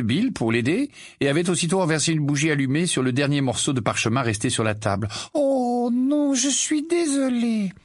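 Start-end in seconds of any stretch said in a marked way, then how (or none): 5.3-7.7 put-on high, slow girlish voice; slow, bright young witch; Tonk's voice. Tonk answering Bill, in a high slow girlish voice.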